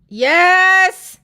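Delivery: loud, high drawn-out yes; calling out. Real, not acted. Mother answering daughter's dessert question, calling out loudly. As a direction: holler it to someone out of the room.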